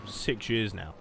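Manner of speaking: ordinary talk, nothing marked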